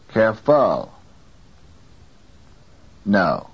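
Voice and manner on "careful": CAREFUL wrongly stressed on FUL; wrongly placed stress. Shown as a demonstration. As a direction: put the weight on the second syllable of CAREFUL. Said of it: "Is this acceptable English?".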